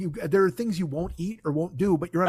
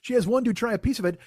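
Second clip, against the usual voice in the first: ordinary talk, nothing marked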